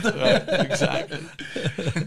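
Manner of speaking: drawn-out laughing agreement. Real, not acted. Guest laughing along with host's joke.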